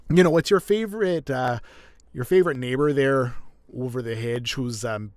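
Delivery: hammed-up Canadian with tightened throat. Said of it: "it's a stereotypical accent".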